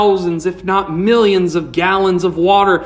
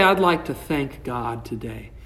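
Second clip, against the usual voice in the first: softer, lower, lifeless thank-you; limp thanks; 7 semitones lower, 8 dB quieter than usual. Shown as a demonstration. Preacher mocking a dull churchgoer, half-hearted. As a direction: say it like you barely care. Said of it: "Don't just say".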